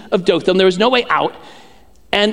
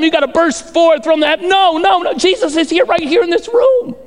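high, driving proclamation; full-force preaching; 9 semitones higher than usual. Real, not acted. Preacher correcting how the room prays, full-force preaching. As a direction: rise to an emphatic peak.

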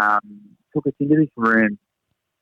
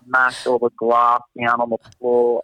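ordinary delivery

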